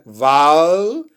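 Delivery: Vowel stretched with a rising tune onto the L; drawn-out final L. Shown as a demonstration. Teacher repeating the syllable for learners, long final L in Vowel. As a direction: hold the L at the end of Vowel. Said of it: "olha o L, né?".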